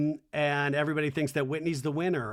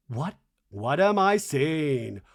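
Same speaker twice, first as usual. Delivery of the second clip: gasp, then slow stretched disbelief growing louder; hammed-up astonishment; 4 dB louder, slower than usual. Lisa stopping cold at the lookalikes, gasping mock astonishment. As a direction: play a stunned reaction, drawing each question out. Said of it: "then she stops cold".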